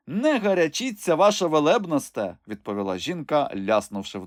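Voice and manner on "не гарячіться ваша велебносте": high crone voice, sly; mocking old woman. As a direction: tease the bishop. Old woman mocks bishop. (глузлива висока стара)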